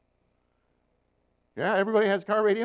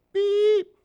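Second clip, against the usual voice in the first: very high, much louder squeaky bleep; mock censor bleep; 9 dB louder than usual. Shown as a demonstration. Preacher censoring client's curse for church, shrill bleep. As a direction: cover the swear word with a shrill beep. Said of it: "I'm gonna bleep".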